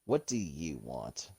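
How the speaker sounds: low, slow genie with weight on you; genie act, stress on YOU. Acted. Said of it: "act like a genie".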